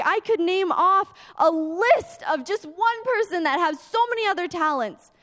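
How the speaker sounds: a distraught believer crying out on his knees; desperate prayer. Retold or imitated. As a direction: beg God in despair. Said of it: "I fall on my knees and I'm like".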